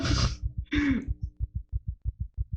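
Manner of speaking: soft breathy frightened laugh; quiet shaky-nerved chuckle; nervous laugh. Real, not acted. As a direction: laugh off the fear under your breath. Nervous laugh after terror.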